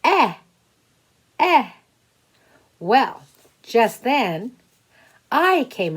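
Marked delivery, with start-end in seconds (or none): 0.0-1.7 "Eh, Eh" said high and loud as a short vowel. Shown as a demonstration. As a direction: sound out the short e twice. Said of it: "I'm gonna call you".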